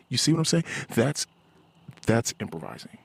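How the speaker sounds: normal talking voice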